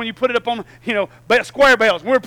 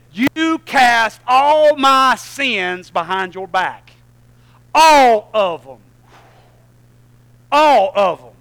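drawn out and louder, leaning on all; slow preaching climax; 4 semitones higher, 11 dB louder, much slower than usual. Acted. Pastor driving home forgiven sins, slow and loud. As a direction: stretch each word and land it hard.

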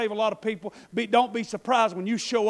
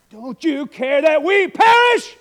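slow, loud, high panicked cry; panicked disciples; 6 semitones higher, 14 dB louder, slower than usual. Acted. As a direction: shout it over the storm in fear. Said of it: "He said".

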